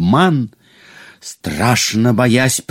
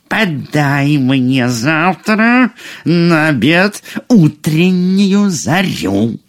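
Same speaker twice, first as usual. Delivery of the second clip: slow, higher-pitched ogress queen; scary ogress voice; 6 semitones higher, slower than usual. A narrator's reading. Ogress queen ordering dinner from her cook, in a slow, scary voice. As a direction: draw out the order like a man-eating queen. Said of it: "страшным людоедским голосом".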